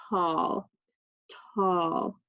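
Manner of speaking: tall stretched slowly, sound by sound; sounding out words. Shown as a demonstration. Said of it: "I'm gonna sound out the word".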